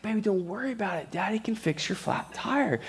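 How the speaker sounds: soft, gentle daddy reassurance; soothing dad. Retold or imitated. Dad comforting his crying daughter, soft and gentle. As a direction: hush her like a dad calming his upset kid. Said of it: "And I was like".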